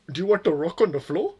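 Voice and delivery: swooping question in a put-on Indian accent; mock Indian accent. Imitating merchant hawking to passers-by, mock Indian accent. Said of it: "go back into the accent".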